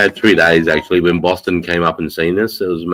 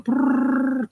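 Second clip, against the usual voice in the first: buzzing noise; 5 dB quieter than usual. Retold or imitated. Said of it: "it's going".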